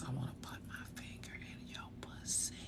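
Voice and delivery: very soft, sultry whisper; hushed bedroom whisper; erotic whisper. Shown as a demonstration. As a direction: whisper it seductively to a partner. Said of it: "that sounds a little bit more erotic".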